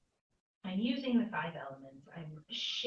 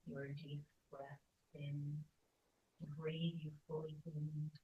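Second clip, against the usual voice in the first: guided meditation. Real, not acted. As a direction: draw the words out, leaving room to breathe.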